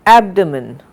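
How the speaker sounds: "abdomen" stressed on AB, with a weak, reduced "do"; correct stress. Shown as a demonstration. Teacher modelling for students to follow, "abdomen" stressed on AB. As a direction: punch the first syllable: AB-duh-mun for "abdomen". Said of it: "with correct enunciation and correct stress".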